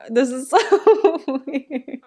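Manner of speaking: drawn-out words through real laughter; amused laughing. Real, not acted. Viewer laughing at the characters' odd situation, amused. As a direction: laugh while saying it, stretching "so".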